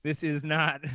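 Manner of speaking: usual speaking voice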